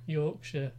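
a quick, self-mocking try at a Yorkshire accent. Shown as a demonstration. Arguing whether Sheffield is northern, a bad Yorkshire accent. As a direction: say Yorkshire the way a Yorkshireman would, badly. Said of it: "that was a terrible accent".

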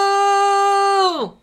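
high, loud stadium-announcer shout; goal call. Acted. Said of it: "That sounds like a goal call".

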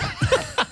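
burst of laughter. Real, not acted. Laughs at the wife joke.

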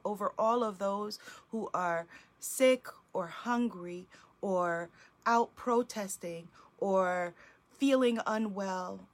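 earnest spoken prayer to God, softening at the ends of phrases; heartfelt prayer. Acted. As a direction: pray aloud for the circle, grateful and steady. Leads a group prayer.

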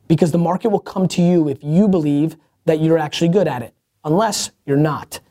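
ordinary delivery

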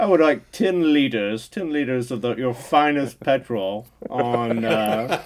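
affected British-style grandeur, drawn out at the end; hammed-up genteel request; mock proper customer. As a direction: order fuel like a pretend aristocrat. Parodying over-proper speech at gas station.

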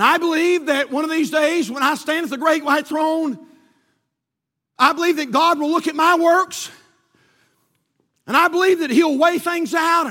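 loud, high, building preacher cry; preaching at full force. Acted. Preacher to the church, loud and rising. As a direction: preach it out to the congregation, climbing.

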